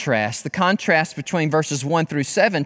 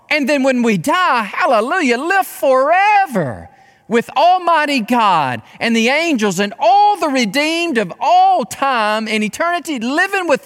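high, exultant proclamation with stretched key words; overjoyed, high-pitched pulpit delivery; excited preaching; 6 semitones higher than usual. Real, not acted. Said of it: "Absolutely excited".

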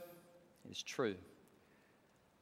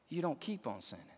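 usual speaking voice

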